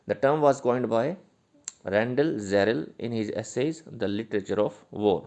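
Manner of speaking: reading notes aloud slowly and loudly, word by word; slow dictation. Shown as a demonstration. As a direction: dictate it so students can write it down.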